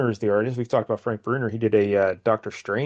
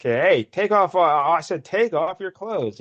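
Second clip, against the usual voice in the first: higher, insistent acted order; exasperated commissioner; 6 semitones higher, 3 dB louder, slower than usual. Commissioner ordering Howard to strip, acted exasperation. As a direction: repeat the order with drawn-out, higher-pitched insistence.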